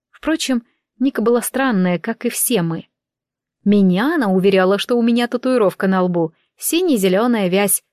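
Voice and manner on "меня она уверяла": usual speaking voice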